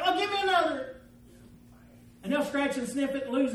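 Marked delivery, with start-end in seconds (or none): 0.0-1.0 high-pitched mock gambler's demand; eager gambler. Losing gambler demanding another ticket, mock eager cry. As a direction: imitate a hooked gambler calling out. Imitating scratch ticket losers buying more.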